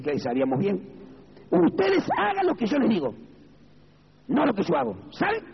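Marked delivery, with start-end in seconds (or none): 1.5-5.4 high-pitched mock priestly command; imitated priest. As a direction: mimic a priest instructing his flock, pitched well up. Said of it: "El cura de la Iglesia Católica ... nos decía".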